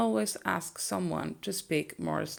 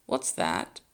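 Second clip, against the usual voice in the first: normal talking voice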